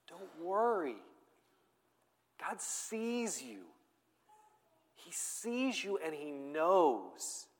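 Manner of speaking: soft, very slow, tender comfort; sweet reassurance. Acted. Preacher voicing Jesus comforting his followers, sweet and tender. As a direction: gently soothe a worried listener, drawing out the words. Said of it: "I think he says this sweetly".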